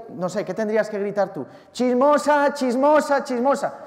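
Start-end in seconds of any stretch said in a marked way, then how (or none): 1.7-3.7 high, loud repeated cry, fading on the last; mock street shout. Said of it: "Tú imagínate tú ir gritando por la calle".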